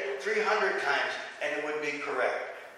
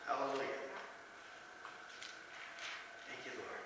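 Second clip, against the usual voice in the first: murmured under the breath; near-silent personal prayer; hushed prayer; 5 semitones lower, 20 dB quieter than usual. Real, not acted. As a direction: whisper a private thanks to God. Preacher's private aside to God.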